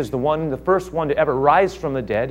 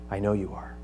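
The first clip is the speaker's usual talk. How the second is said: much softer, low and intimate; near hush; 5 semitones lower, 10 dB quieter than usual. Acted. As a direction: drop almost to a murmur. Paul appeals to Agrippa.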